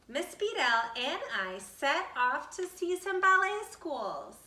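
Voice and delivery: plain reading voice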